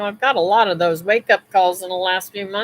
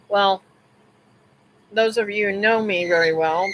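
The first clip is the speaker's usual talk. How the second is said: usual speaking voice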